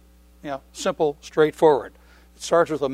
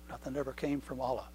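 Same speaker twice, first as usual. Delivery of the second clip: soft echo; 15 dB quieter than usual. Acted. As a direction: repeat it much softer, sinking. Repeated much softer.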